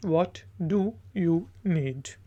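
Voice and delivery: What do you need? said at half speed, each word spaced out; slow, clear repeat. Shown as a demonstration. Teacher repeating the phrase slowly for learners. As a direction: drag it out so learners can copy. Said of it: "repeat for learners after translation".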